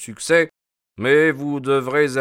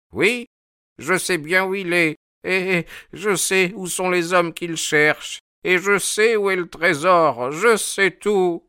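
put-on secretive old sailor; 9 semitones higher than usual. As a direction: boast like a stubborn old mariner. Old sailor boasts of his knowledge.

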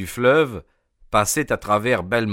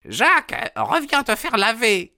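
woman calling out; 14 semitones higher than usual. A narrator's reading. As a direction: shout after the boy, scolding. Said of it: "cria-t-elle". (femme qui appelle l'enfant, voix aiguë et forte)

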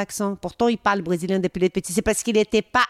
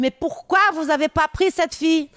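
higher-pitched incredulous question, lightly acted; retold protest; 7 semitones higher than usual. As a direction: imitate an editor challenging a decision. Retells the editor's protest. (protestation étonnée, rapportée)

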